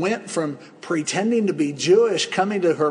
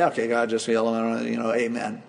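offhand prayer; 6 semitones lower than usual. Pretend. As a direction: rattle it off without caring. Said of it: "it's just a quick prayer tossed out there".

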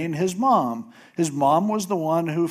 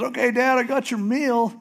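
much higher, eager announcing; cheerful son; 9 semitones higher than usual. Retold or imitated. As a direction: bounce in proudly with the meal. Esau cheerfully brings meal.

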